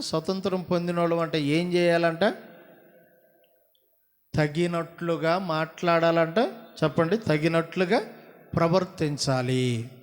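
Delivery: ordinary talk, nothing marked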